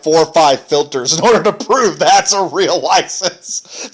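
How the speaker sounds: slow, laughing through the words; real laughing. Real, not acted. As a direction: let the laugh break into the sentence. Joking about wife getting carded.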